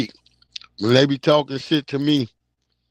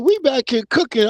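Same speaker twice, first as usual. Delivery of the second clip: high-pitched imitation of a Louisiana woman; lightly put-on New Orleans accent, high; imitated Southern accent; 9 semitones higher than usual. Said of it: "fell in love with the accent".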